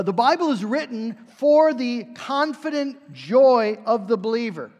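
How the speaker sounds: usual speaking voice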